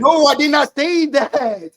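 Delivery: loud, slow, emphatic denial; heated pundit debate. Real, not acted. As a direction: push back hard, drawing it out. Heated sports argument, denial.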